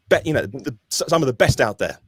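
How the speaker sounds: ordinary talk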